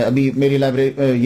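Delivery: usual speaking voice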